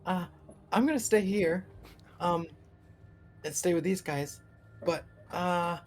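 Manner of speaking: high, hesitant gnome voice; tiny creature speaking up haltingly; little gnomish creature. Acted. Gnome telling the rider she's staying, high hesitant voice. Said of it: "little gnomish creature that you met".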